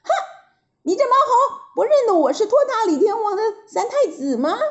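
high, fast, huffy retort; acted little prince's outrage; indignant boy prince. A narrator's reading. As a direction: bristle with offended pride. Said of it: "眉毛一竖， 眼睛一瞪".

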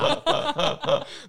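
falling giggle; high laugh falling away. Real, not acted. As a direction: giggle that tumbles down. Laughing at own joke.

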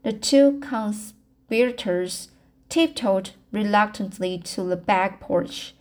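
usual speaking voice

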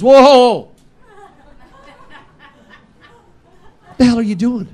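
high, loud startled yelp; shocked outburst. Retold or imitated. Brother at dessert table, high shocked yelp. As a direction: jump back in shock.

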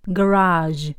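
American "garage", stress on the second syllable; American pronunciation. Shown as a demonstration. Teacher echoing the American recording, American pronunciation. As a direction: say "garage" as guh-RAHZH, American style. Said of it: "我都快忘了美式要怎么说".

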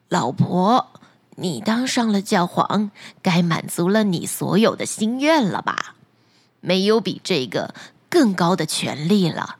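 put-on low, gentle old man voice; a meek old husband coaxing his wife; humble fisherman. Fisherman asking his wife to be content, low and meek.

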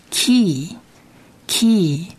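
key，key said slowly and apart; slow word demo. Shown as a demonstration. Teacher models the first word for students. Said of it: "第一个字".

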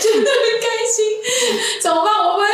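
high, giddy laughing delivery; real giggly joy, high-pitched; really happy. Real, not acted. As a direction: squeal it through delighted laughter.

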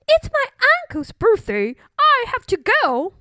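high, nervous excuse; flustered bully bird. Acted. Bully invents excuse to flee.

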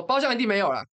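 reenacted angry yell; retold scolding. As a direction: lash out at the group again. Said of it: "我就大骂".

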